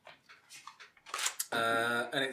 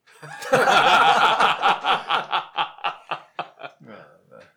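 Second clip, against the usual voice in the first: long, loud burst of laughing; loud laughter; 3 semitones higher, 16 dB louder than usual. Real, not acted. Player laughing at a joke about dodging arrest, loud guffaw.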